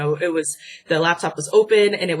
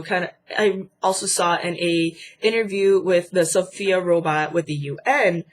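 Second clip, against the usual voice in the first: normal talking voice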